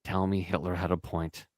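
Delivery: low, soft, breathy-close come-on; mock seductive murmur. Husband teasing his wife on the phone, mock seductive murmur.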